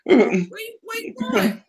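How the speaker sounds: high, loud teasing call. Retold or imitated. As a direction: call out after him, teasing. Repeats the call, louder.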